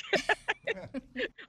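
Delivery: high, bright burst of laughter; proud giggle; delighted laugh. Real, not acted. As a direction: laugh at your own boast. Laughs at own boast.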